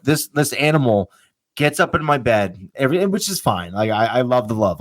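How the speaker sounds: ordinary delivery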